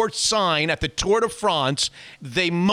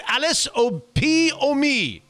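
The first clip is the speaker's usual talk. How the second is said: high, slow put-on German accent; hammed-up German accent; 7 semitones higher, slower than usual. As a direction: draw it out in a playful German accent. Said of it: "in my favorite German accent".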